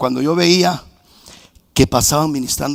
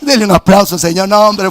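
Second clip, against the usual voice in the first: usual speaking voice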